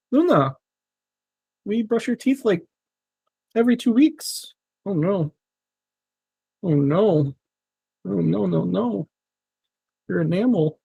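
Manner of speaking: high, cooing sympathy for Luna; pet talk. Real, not acted. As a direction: fuss over the cat's teeth. Talks to his cat Luna.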